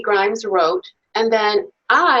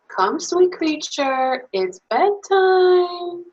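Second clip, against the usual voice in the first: gentle call; 9 semitones higher, slower than usual. Acted. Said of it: "the minute I say".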